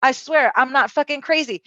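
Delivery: high-pitched, urgent swearing; insistent pleading. Retold or imitated. Insisting to wife she is sane.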